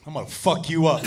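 quiet, seething mock threat; low menacing threat. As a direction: drop low and threaten with deadly calm. Snapped buddy threatens her.

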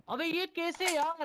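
drawn-out, high-pitched amazement; amazed disbelief. Real, not acted. Gamer reacting to a teammate's kill, amazed.

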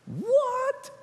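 high squeaky burst of disbelief; surprised what. Retold or imitated. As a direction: leap way up in mock astonishment. Mock surprise at old Sarah pregnant.

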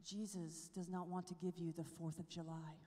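a friend's quiet, weighty words; hushed quote. Retold or imitated. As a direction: say it hushed, like a secret truth. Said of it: "She said to me this".

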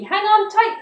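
high, loud warning call; urgent cry. A narrator's reading. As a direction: cry it out over the wind. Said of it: "he cried".

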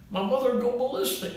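imitating patient. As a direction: toss it off quick like a worried patient. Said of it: "That's the way she talked".

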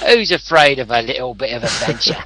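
hammed-up British accent. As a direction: play the plucky British adventurer. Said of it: "Do that again".

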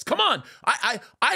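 ordinary talk, nothing marked

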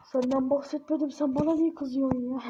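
normal talking voice

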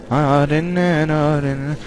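ordinary talk

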